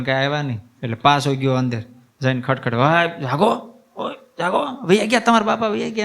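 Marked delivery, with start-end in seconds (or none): 2.7-6.0 put-on high eager child's voice calling a friend awake; a small boy urgently rousing his pal, pitched way up; boy hissing up high for God to wake, father's gone; child waking friend. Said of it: "એમ કહીને જગાડ્યા હો ભગવાનને".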